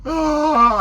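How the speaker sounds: a high, loud open-mouthed yawning ah; big yawn. Retold or imitated. Trucker bored at the wheel starts a loud yawn. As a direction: let a full yawn rip out. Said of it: "So I was like".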